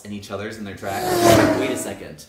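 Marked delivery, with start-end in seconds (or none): none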